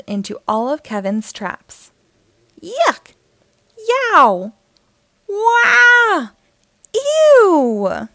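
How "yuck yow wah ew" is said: high, loud cartoon yelps of burglars; pained yelps. Burglars crying out as they slip and fall, yelping loud and high. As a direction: yelp and squeal as each trap hits.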